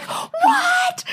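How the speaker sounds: a high, shrieking what of disbelief; amazed shriek. Retold or imitated. Retelling to her cohosts how she reacted to the card trick, a high, astonished shriek. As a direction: squeal it like the trick just blew your mind.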